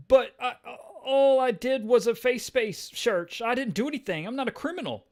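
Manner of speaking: high, whining protest, loud then easing; panicked Paul. Acted. Paul grabbed by Punisher, protesting in high panic. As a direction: plead innocence in a hammed-up high voice. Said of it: "Punisher grabs Paul".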